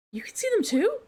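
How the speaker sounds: high, rising disbelief; shocked question. Acted. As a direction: ask in sudden surprise. Character shocked discovery.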